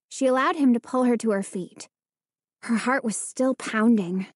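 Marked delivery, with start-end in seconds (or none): none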